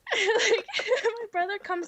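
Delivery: high, giggling through the words; real laughter breaking the line; laughing. Real, not acted. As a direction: laugh as you tell it. Laughing while telling.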